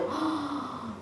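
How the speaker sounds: soft, drawn-in breath of wonder; awed gasp. Real, not acted. Unboxer admires how the top fits, awed gasp. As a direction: gasp quietly in delight.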